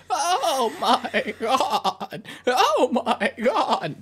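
high, stretched, quavering wail through laughter; shaky vibrating voice. Retold or imitated. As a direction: mimic a trembling, warbling voice while laughing. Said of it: "She got the vibrating voice".

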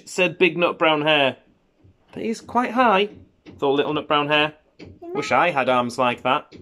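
unhurried picture-book narration with lifted key words; children's story reading. A narrator's reading. Parent reading a bedtime hare story to a child, storytelling. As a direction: read it to a little child, savouring the phrases.

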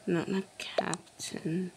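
whispering. Real, not acted. Whispered aside while playing.

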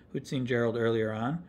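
plain reading voice

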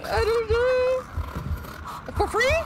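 drawn-out squeaky whine; high mock whine. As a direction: stretch it high and slow. Baffled by game event.

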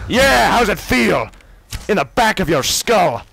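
high, loud gloating yell; taunting yell. Real, not acted. Gamer gloating loudly after a sneak attack. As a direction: crow over the kill.

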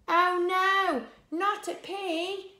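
high, drawn-out storybook alarm; loud "oh no", then stretched horror; gasped dismay. Acted. Vegetables hearing of the pea on the loose, gasped dismay. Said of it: "everyone gasped".

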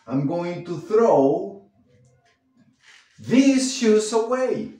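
slow read with a break in the middle; slow split demo. Shown as a demonstration. Teacher slowly showing how to split the phrasal verb.

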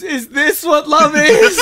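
high, loud, slow question through laughter; wondering laugh. Acted. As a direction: ask it in giggling wonder. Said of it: "Rebecca turns back to the parents and goes".

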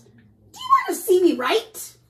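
high, loud, pesky goose. Acted. Goose pestering the polar bear, high goose voice. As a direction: squawk it out eagerly, high and loud. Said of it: "Then the goose says".

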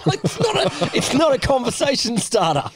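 high-pitched laughing words; laughing through words. Real, not acted. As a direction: squeak the line out while cracking up. Laughing at rude remark.

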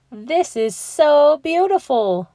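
high, loud, slow delighted exclaim. Acted. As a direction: marvel at the gift. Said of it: "Mama Llama exclaims".

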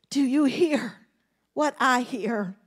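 softly asking the congregation over music; soft reprise. Real, not acted. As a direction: pull back and half-sing it softly.

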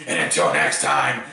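low, deliberate closing line; deep sign-off. Shown as a demonstration. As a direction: drop the voice low for the outro. Retrying his sign-off line.

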